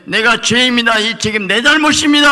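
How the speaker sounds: 높고 크게 터뜨리는 고백; 목청 높여 죄를 고백하듯. Acted. (설교 중 주님 앞에 회개하는 높고 큰 외침)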